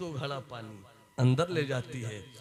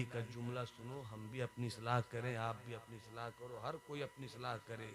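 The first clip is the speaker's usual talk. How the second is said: much softer, confiding to the listeners; hushed aside; 3 semitones lower, 15 dB quieter than usual. A narrator's reading. Storyteller confiding to the audience, much softer. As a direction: drop almost to a murmur.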